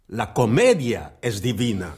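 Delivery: ordinary talk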